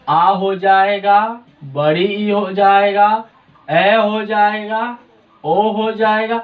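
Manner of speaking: slow, drawn-out examples of long vowels; slow listing. Shown as a demonstration. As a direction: stretch each example for the students. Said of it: "teacher listing long vowels". (धीरे धीरे उदाहरण गिनाना)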